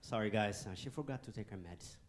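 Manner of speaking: low, soft mock aside; whisper-like joke to the friends about mom. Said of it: "turn to my friends and be like".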